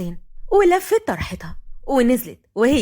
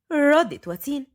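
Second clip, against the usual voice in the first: usual speaking voice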